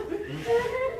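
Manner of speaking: high, short cracking giggle; a quick squeaky laugh. Real, not acted. Laughing at herself.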